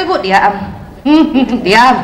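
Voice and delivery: put-on a firm, harsh hush at the child; mother losing her softness, hushing the child sharply; stern command. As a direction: snap the word diam like an impatient mother. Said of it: "Suaranya nggak lembut lagi".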